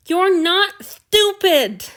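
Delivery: loud, high, slow insistence; frustrated pleading. A narrator's reading. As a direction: push back, frustrated and pleading. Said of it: "Frustration and pleading".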